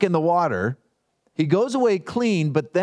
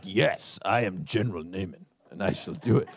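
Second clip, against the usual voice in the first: much lower, softer mock-heroic general; pompous general; 10 semitones lower, 6 dB quieter than usual. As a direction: puff up like a grand general, then sink the voice low. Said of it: "you'd be like".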